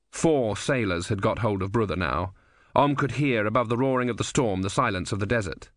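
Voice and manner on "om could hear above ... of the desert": ordinary delivery, nothing marked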